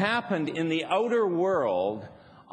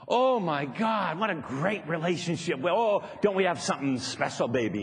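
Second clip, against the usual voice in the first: gushing sweetness; 3 semitones higher than usual. Retold or imitated. Making up, gushing to partner.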